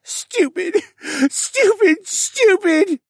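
very high, tearful character voice; high-pitched outburst through sobs; sobbing self-reproach. A narrator's reading. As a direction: scold yourself in a high, sobbing voice. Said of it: "muttering one word under his breath between suppressed sobs".

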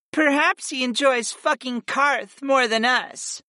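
high-pitched grumble; grumbling goddess. A narrator's reading. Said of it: "Fi grumbled".

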